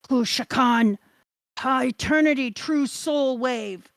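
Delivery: dramatic anime attack shout; attack-move call. Acted. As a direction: declare the special move, stretching the final words.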